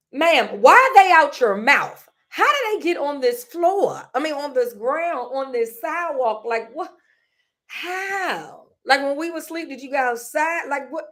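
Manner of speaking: high, loud disbelieving questions; incredulous. Retold or imitated. Daughter grills her mom about the teeth, high and incredulous. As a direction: pepper her with shocked questions.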